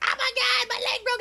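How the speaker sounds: very high whiny mock girl voice; sassy Sandra. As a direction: whine like a dramatic passenger. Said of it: "she's sassy Sandra".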